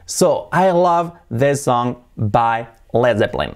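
very slow English, "Led Zeppelin" as led ZEP-lin; native-style English. Shown as a demonstration. Teacher modelling the name in a sentence, slow English pronunciation. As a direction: spell out the sentence slowly, two-syllable "Zeppelin". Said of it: "Así sonaría".

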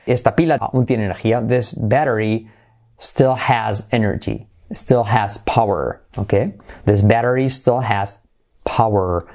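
usual speaking voice

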